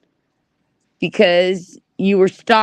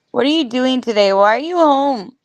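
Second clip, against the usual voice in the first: coaxing; 3 semitones higher than usual. Acted. Talking to Otto off-screen.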